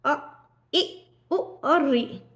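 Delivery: brief, separate अ, इ, उ, रि; short vowels. Shown as a demonstration. Teacher repeats short vowels for students, brief अ, इ, उ, रि. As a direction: keep अ, इ, उ, रि short. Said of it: "ह्रस्व हैं".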